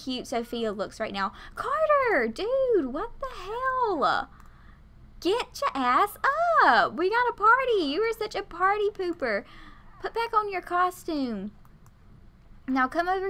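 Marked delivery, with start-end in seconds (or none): 1.5-4.3 high, drawn-out exasperated whine; exasperated scolding. Real, not acted. Player scolds Carter at the party, exasperated. Scolds her game character. 5.2-11.5 high, playful telling-off; bossy scolding. Real, not acted. Player scolds party pooper Carter, bossy and exasperated. Scolds her game character.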